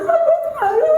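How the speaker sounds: wife crying to her husband in a high, hammed-up whine; mock crying wife. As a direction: squeak it out like a wife in tears. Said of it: "Kalau bini sudah nangis".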